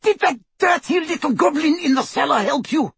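high screeching demand; furious scream. A narrator's reading. Torturer demanding answers about her vault, furious scream. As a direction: scream the question from a high pitch. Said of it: "they heard Bellatrix scream".

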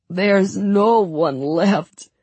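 slow, grief-stricken lament; sobbing. A narrator's reading. Sergei rocking with Marie in his arms, sobbing. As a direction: wail it slowly through tears. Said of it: "he sobbed, tears running down his cheeks".

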